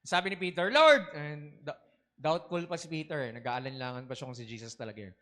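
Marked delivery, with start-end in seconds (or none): 0.7-1.1 loud, high call across water. Acted. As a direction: call out to him over the waves. Peter calls out to Jesus. (malakas na tawag)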